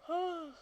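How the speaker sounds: long yawn before speaking; big yawn. Real, not acted. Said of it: "I don't know why I'm yawning".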